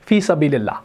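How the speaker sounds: ordinary delivery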